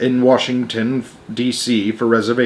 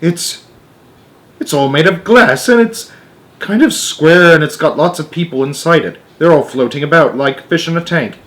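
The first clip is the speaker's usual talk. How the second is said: excited; 5 semitones higher, 4 dB louder than usual. Acted. Astronaut describes craft excitedly.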